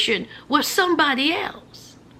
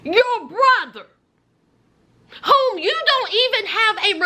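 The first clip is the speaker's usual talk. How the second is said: high-pitched, incredulous tirade; indignant disbelief; 7 semitones higher than usual. Retold or imitated. Girlfriend challenges boyfriend about his brother, indignant disbelief. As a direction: pick the excuse apart in a high voice.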